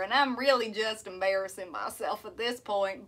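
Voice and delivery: self-mocking country twang; country accent. Shown as a demonstration. Streamer embarrassed by the demo for viewers, country accent. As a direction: confess it in a put-on country accent. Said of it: "I can do a country accent".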